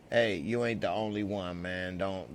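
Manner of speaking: ordinary delivery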